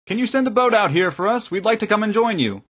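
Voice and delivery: high, loud request across water; high-pitched group hail; calling from shore. A narrator's reading. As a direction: shout over to the boat in a high voice. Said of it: "three people called out from the shore".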